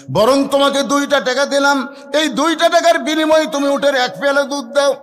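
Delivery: loud, high acted plea; high, forceful declamation; pressing offer. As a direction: push the bargain at full preaching voice. Omar pressing his offer, acted.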